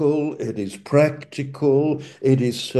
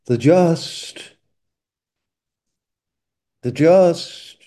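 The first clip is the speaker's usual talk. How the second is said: "the just" lifted high and loud, twice; preacher leaning on "the just"; stress on the just; 4 semitones higher, 4 dB louder, slower than usual. Shown as a demonstration. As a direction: hammer "The just" as the key words. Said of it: "the emphasis is on".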